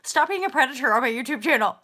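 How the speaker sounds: loud, high mock telling-off; mock scolding. Telling off a flirty viewer in mock outrage. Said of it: "I'm like".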